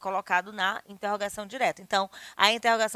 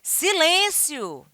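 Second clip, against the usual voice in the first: loud, drawn-out high order; slow, forceful command; stern command; 7 semitones higher, 6 dB louder, much slower than usual. Shown as a demonstration. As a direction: demand silence from a room.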